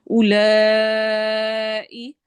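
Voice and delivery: slow اولائی, waw silent; correct reading. Shown as a demonstration. Teacher demonstrating the silent waw for students, slowly. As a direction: draw out اولائی without sounding the waw. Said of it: "اس کو ہم اولا نہیں پڑھیں گے".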